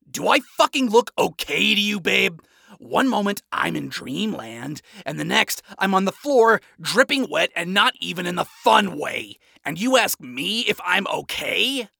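loud sarcastic outburst; furious, biting rant; angry rant. Acted. Adam yells at Charlie.

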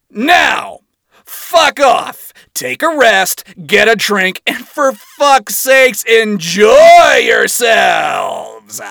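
high, loud dismissive bark; loud commanding shout. Acted. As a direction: send the troops off loudly. Adam dismissing troops.

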